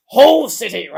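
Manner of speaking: hyped fixture announcement. Pretend. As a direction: belt out the team name like a hype man.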